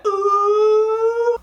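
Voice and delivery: put-on high drawn-out kids' "ooh"; mock crowd "ooh". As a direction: imitate classmates egging on a fight. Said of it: "Yeah, they all did that".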